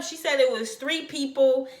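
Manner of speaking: ordinary talk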